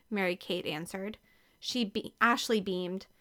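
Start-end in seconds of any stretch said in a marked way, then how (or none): none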